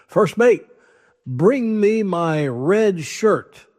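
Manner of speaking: captain ordering his first mate; commanding captain. Acted. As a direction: bellow it like a pompous captain. Captain commanding before battle.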